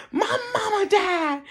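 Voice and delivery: high-pitched mock weeping; tearful retelling. Retelling the news of mama's death, tearfully. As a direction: whimper it as if crying. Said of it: "Now I'm crying".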